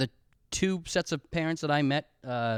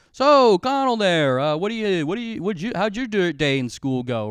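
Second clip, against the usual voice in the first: awkward friend's dad; 4 semitones higher, 5 dB louder than usual. Retold or imitated. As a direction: fumble for small talk like a stiff dad. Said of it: "Nick's dad was talking to me, being like".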